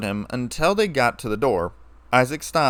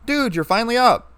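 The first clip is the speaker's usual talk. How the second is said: high, louder cheerful call; happy greeting; 10 semitones higher, 5 dB louder than usual. A narrator's reading. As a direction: bounce in glad he's awake at last. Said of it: "she said in a happy tone".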